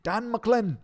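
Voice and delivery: high, loud hammed-up Scottish; mock Scottish accent. Streamer trying Dan's surname, mock Scottish accent. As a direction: call the name out in cartoon Scots. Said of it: "it's Scottish, Scotland".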